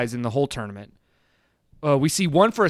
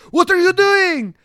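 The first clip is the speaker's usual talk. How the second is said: high, loud exasperated yell; mock frantic yelling; 14 semitones higher, 6 dB louder, slower than usual. Voicing Van Damme urging Jackson to finish him, frantic yelling. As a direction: yell it in hammed-up alarm, last line drawn out.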